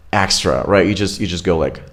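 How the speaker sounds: usual speaking voice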